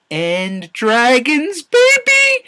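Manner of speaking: slow, then a very high, loud whoop; dragon glee. Real, not acted. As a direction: cheer it like a fan at a concert. Gleeful about the dragons.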